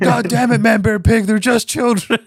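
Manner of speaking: high, loud, frantic cartoon outburst; hammed-up high yell at the kids; exasperated Al Gore. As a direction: shriek it like a panicking politician. Quoting Al Gore in the cave.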